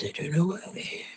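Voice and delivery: quiet distorted monster mumble. Pretend. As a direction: mumble it like a creature. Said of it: "Monster voice".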